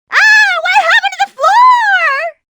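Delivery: high, loud startled scream as the floor drops. Acted. Falling through the floor, high panicked scream. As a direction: yelp in panic while falling. Floor collapses under her.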